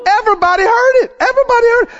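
very high, exultant cry; excited preaching. Real, not acted. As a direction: cry it out twice at the climax.

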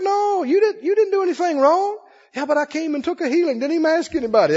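lightly voiced gentle reassurance, high; gentle Jesus and worried woman. Jesus reassures the scared woman, lightly voiced and gentle. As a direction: soothe her lightly, she frets back. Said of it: "He don't want her to be scared".

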